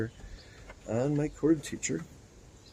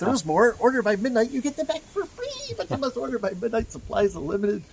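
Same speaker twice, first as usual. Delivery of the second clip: much higher, hyped pitchman; infomercial voice; 12 semitones higher than usual. Pretend. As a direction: oversell it like a TV ad. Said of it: "Do I sound like an infomercial yet?".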